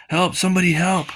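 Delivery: mock panicked plea; cry for help. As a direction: yelp it like a frightened victim. Character cries out for help.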